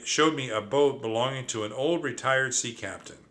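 ordinary delivery, nothing marked

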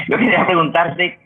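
ordinary talk, nothing marked

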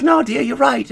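put-on a sing-song British accent done for laughs. Imitating a Gogglebox couple agreeing, mock British accent. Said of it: "I can't do the damn, uh, what is it called? Accent".

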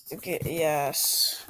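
ordinary talk

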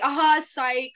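spring the trick with mock triumph; gloating gotcha. Friend pretending to fool the worker, gloating gotcha.

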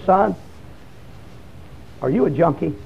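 low, soft question with a long pause; hushed confrontation. Retold or imitated. Old preacher stops a young man by his T-shirt, hushed and low. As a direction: imitate an old man asking gravely and quietly.

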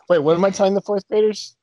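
normal talking voice

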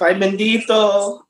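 high, drawn-out sympathetic coo; playful pity. Real, not acted. Sympathising teasingly with upset friend.

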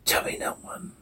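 distorted ghostly whisper. Acted. Daddy's warped voice luring Jane, whispered. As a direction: breathe it out, eerie and warped. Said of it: "Daddy distorted voice".

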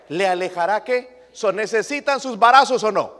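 a pastor thundering at the church; full-force preaching. Acted. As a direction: preach it hard at the congregation.

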